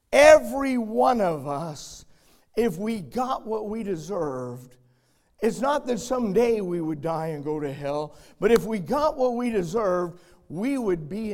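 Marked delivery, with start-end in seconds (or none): none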